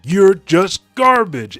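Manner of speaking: very high, very loud, slow scream. Retold or imitated. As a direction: scream the insult at the enemy. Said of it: "Leuven is screaming".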